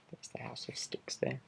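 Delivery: plain reading voice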